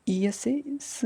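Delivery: ordinary delivery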